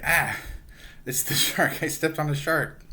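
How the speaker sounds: Jughead steps on the shark and yelps, mock startled.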